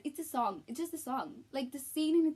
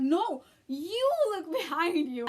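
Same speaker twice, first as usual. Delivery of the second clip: loud, slow, laughing; laughing shout at screen; 4 semitones higher, 6 dB louder, slower than usual. Retold or imitated. Fan yelling at the cinema screen, laughing shout. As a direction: yell it at the screen, giggling. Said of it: "I was like".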